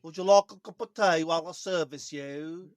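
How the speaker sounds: loud, hammed-up Midlands accent; attempted Midlands accent. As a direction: try out a thick Midlands accent, loudly. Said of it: "say that in a Midlands accent".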